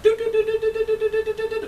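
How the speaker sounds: very high, rapid monotone "do-do-do"; imitated alarm noise. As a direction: mimic an alert going off on one piercing note.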